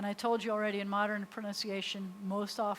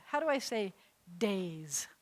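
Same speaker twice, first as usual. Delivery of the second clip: ordinary delivery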